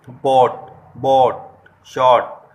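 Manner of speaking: each word punched out loud as a teaching example; demo words, loud. Shown as a demonstration. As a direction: model each word for students. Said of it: "अगर मैं ये उच्चारण करता हूँ".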